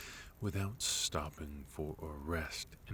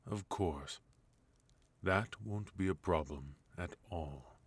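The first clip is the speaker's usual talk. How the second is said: normal talking voice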